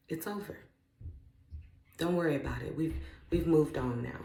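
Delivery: put-on very soft, gentle reassurance to a child; tender hush. Mother comforting her sorry child, very soft and tender. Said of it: "I tell them".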